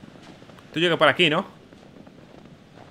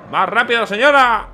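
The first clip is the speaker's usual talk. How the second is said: shouting; 7 semitones higher, 6 dB louder, slower than usual. Real, not acted. Urging the character on.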